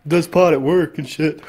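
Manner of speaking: mocking stoner coworker impression; lightly voiced slacker with chuckles breaking in; imitated background guy. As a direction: imitate a pot-smoking coworker chiming in. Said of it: "That's what he sounded like in the back".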